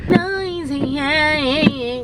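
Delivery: high squealing yeah; excited squeal. Real, not acted. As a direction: squeal with glee.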